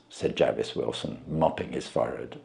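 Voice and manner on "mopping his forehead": plain reading voice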